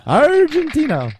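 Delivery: hammed-up high Spanish Argentina; Spanish accent. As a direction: sing out Argentina like a local. Mock exaggerated repeat.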